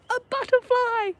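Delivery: high, slow hammed-up cry of discovery; spring the reveal like a scare; mock alarm. Poem's climax, sudden sighting.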